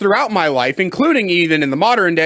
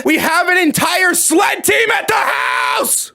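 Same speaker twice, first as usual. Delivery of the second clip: higher, slow, shouted disbelief; exasperated shout; 9 semitones higher, slower than usual. Real, not acted. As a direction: yell it like you can't believe the dog count. Exasperated exclamation about the dogs.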